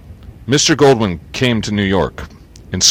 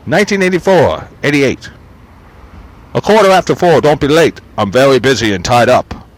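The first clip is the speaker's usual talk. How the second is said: impatient boss; 4 semitones higher, 5 dB louder than usual. Acted. Voicing old boss on phone.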